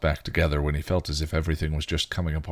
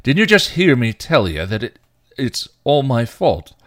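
higher, loud, slowed accusation; angry snarl; 7 semitones higher, 9 dB louder than usual. A narrator's reading. Spike furious that Giles didn't hear him, loud snarl. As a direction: hammer the words back at him. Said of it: "he snarled".

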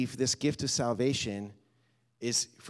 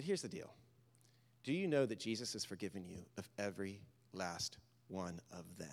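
soft, low, tender assurance; quiet reassurance; 6 semitones lower, 11 dB quieter than usual. Retold or imitated. Pastor assures the ashamed man he is forgiven, softly. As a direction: lean in and reassure him softly.

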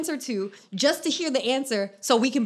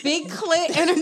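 laughing; 5 semitones higher, 3 dB louder than usual. Real, not acted. Repeating joke through laughter.

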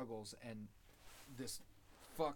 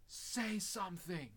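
higher, slow, drawn out plea; Elliot pleading; 8 semitones higher, slower than usual. Retold or imitated. Recapping Elliot begging the silent man, high and slow. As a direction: stretch it out high, begging for an answer. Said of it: "He's like".

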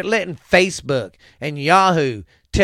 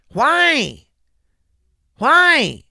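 exasperated shout; 11 semitones higher, 7 dB louder than usual. Real, not acted. Angry rant at hypocrisy.

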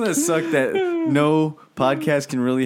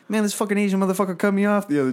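imitating Bill Burr; 8 semitones higher, much faster than usual.

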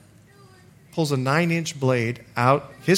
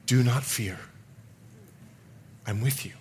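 calm voice of God; 3 semitones lower, 7 dB quieter than usual. Retold or imitated. God reassures a cornered man, calm and soft. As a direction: voice God gently, with long pauses. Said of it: "And God speaks to me".

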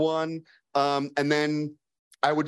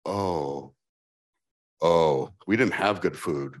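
low, slow mock wail; hammed-up crying lament; 7 semitones lower, slower than usual. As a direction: mourn the bad food theatrically. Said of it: "my partner goes".

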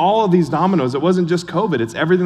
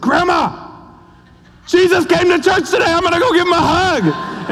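put-on much higher, louder voice of an eager little kid; excited little boy; 4 dB louder than usual. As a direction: play a thrilled five-year-old telling grandma. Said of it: "he said".

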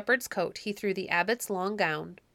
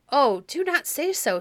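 higher, louder protest; pleading; 6 semitones higher, 5 dB louder than usual. A narrator's reading. Abbot begging the angry king, pleading.

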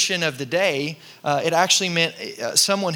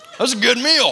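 louder, higher, hammed-up mock of a customer; mock rude customer; 7 semitones higher, 5 dB louder than usual. Pastor mocks the diner who spat on the table, hammed-up and loud. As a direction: sneer it like the slob leaving the table.

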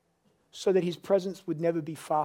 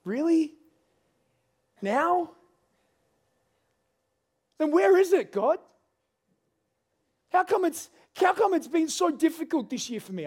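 high, loud, pleading questions; exasperated cry to God; 12 semitones higher, 4 dB louder than usual. Retold or imitated. Pastor retelling his complaint to God, loud exasperated pleading. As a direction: let the frustration burst out at God, pitched up high. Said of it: "And I'm like".

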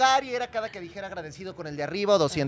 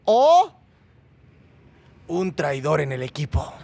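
dramatic reveal; 5 semitones lower, 6 dB louder, much slower than usual. Acted. Host reads the dramatic option.

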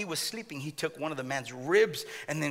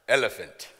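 much lower, slow dopey naming voice; hammed-up low dopey Adam; silly Adam voice; 6 semitones lower, slower than usual. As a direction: drag out each animal name low and slow like a dim caveman. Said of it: "I don't know why Adam talks like that".

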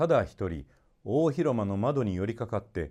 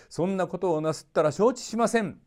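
threatening crowd; 9 semitones higher than usual. A narrator's reading. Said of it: "王様を脅かしました".

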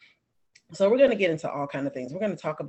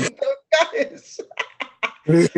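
slow, high, put-on teasing voice; 10 semitones higher, 7 dB louder, much slower than usual. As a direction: stretch it out in a silly high voice. Host teasing about the Scottish accent.

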